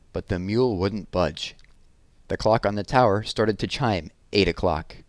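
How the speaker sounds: ordinary delivery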